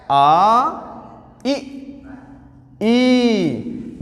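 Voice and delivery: each vowel held long and clear; vowel demo. Shown as a demonstration. Teacher demonstrates the front vowels to the class. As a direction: sound out आ, इ, ई separately and clearly. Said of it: "हमने बोला अ".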